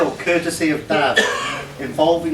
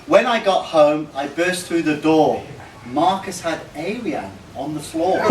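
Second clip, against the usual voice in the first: ordinary talk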